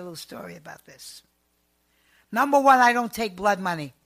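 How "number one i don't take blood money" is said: loud, firm declaration; loud, firm refusal of blood money; emphatic. Real, not acted. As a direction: lay down the rules. Forceful principle statement.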